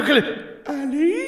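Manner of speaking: frantic threat leaping into a squealed name; Cary Elwes. Retold or imitated. Podcaster mimicking actor calling his wife, absurdly high-pitched. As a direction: imitate a bizarre, very high-pitched delivery. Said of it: "the weirdest line delivery".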